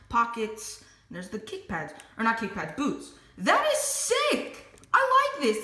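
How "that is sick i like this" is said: excited. Real, not acted. Admiring figure.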